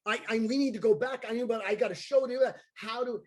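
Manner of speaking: fast panicked rush; freaking out. Retold or imitated. Clown begging to go back for his lost bike, fast panicked rush. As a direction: rattle it off in a panic. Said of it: "I'm freaking out".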